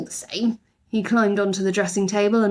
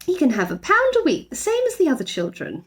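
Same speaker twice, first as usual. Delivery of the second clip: higher, matter-of-fact mother voice; Mrs Brown; 7 semitones higher than usual. A narrator's reading. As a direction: settle it simply. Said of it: "replied Missus Brown".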